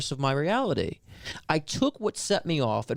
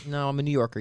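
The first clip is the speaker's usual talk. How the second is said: ordinary talk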